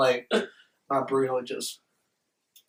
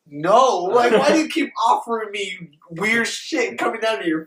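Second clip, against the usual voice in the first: loud, high retold protest; exasperated refusal; 7 semitones higher, 5 dB louder than usual. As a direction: act out a baffled, fed-up refusal. Retold refusal of weird snacks.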